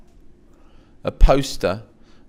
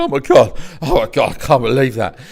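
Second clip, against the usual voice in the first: panicked mock wail; 3 semitones higher, 7 dB louder than usual. Shaking after being booed.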